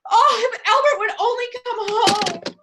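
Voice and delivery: tearful wife wishing for Albert; tearful longing. Acted. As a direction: plead for the husband through tears. Lonely invalid wishing for husband.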